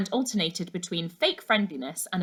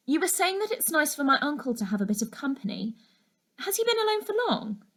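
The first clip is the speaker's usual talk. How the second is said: put-on young girl asking; 7 semitones higher, faster than usual. A narrator's reading. Laura asking about uncle.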